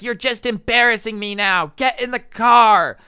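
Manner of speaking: mock mom outburst, last words stretched; exasperated mom. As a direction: imitate a mother ordering her kid away. Embarrassed mom scolding her kid.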